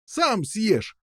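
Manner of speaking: high-pitched comeback; cheeky retort. A narrator's reading. Prisoners answering the giant, high cheeky retort. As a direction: jeer back in a high voice. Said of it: "ответили узники".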